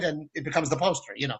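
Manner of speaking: ordinary delivery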